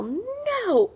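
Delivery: put-on high, whiny toddler No; mock tantrum shout from a toddler; imitating toddler yelling. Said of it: "throw himself on the ground and yell".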